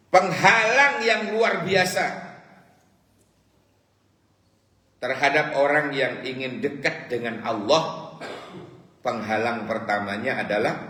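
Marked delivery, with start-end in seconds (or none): 0.1-8.2 emphatic preaching. Acted. Preacher stresses the great obstacle.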